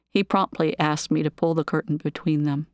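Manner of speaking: ordinary delivery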